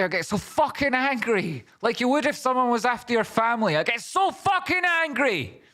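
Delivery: high, hammed-up squeaky anger; mock angry rant. Streamer mocks his own anger in a squeaky high voice. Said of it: "when I get angry".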